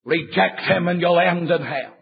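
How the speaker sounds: usual speaking voice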